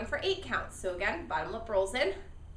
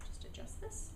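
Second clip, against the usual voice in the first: whispered under the breath; voiceless mutter while fiddling with the mouthpiece; whispered aside; 12 dB quieter than usual. Real, not acted. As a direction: murmur it unvoiced to yourself. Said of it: "aside while adjusting mouthpiece".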